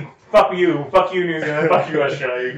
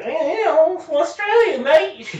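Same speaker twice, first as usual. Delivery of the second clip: much higher hammed-up Australian accent; mock Australian accent; slower than usual. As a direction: ham up an Australian, pushed through the nose. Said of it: "It's all in their fucking nasal passage".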